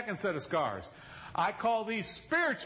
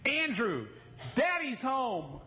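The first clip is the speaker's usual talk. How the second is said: put-on high, sing-song call to a toddler; wife calling their son to the door, high and excited; 9 semitones higher, slower than usual. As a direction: call out brightly through the house. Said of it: "I can hear Lynette saying".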